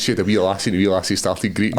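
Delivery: ordinary talk, nothing marked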